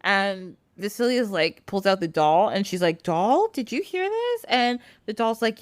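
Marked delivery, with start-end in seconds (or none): 3.0-4.4 lightly voiced high girl. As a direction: ask it up high. Vasilia addressing the doll.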